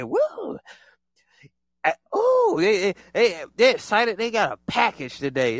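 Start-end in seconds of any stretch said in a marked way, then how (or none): 0.0-2.6 breathy higher whoa into a squeal; mock whispered whoa. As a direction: act giddy with stolen energy. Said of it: "They just like".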